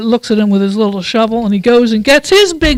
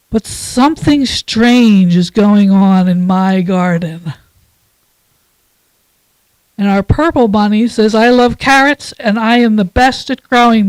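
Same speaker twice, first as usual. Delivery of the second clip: put-on reading to children. Reads picture book to children.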